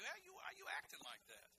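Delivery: a hint of a scolding officer; mild imitation of police questioning a speeder.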